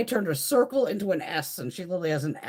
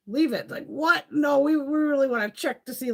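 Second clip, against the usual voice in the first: incredulous; 10 semitones higher, 5 dB louder than usual. Retold or imitated. Incredulous retold exchange.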